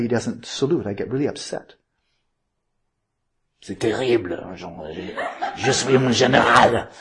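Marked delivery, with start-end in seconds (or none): 3.6-6.9 hammed-up French general, bad French accent; crummy French accent. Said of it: "I start speaking in a French, French accent or a very crummy imitation of that".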